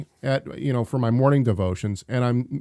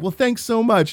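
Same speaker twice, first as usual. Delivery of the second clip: cheerful thanks; 9 semitones higher than usual. Retold or imitated. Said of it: "I was like".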